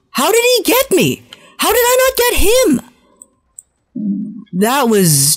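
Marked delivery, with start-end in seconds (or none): 0.1-2.9 much higher squeaky disbelief; rising outrage at the game; baffled outrage. Real, not acted. Gamer replays his death, squeaky baffled outrage. Baffled after losing a fight.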